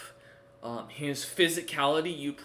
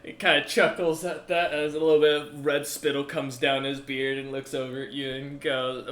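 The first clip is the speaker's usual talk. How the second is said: normal talking voice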